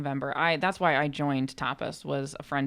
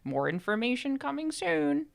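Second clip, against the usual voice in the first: teasing announcement; 10 semitones higher, slower than usual. Retold or imitated. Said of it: "typed into the Discord that was like".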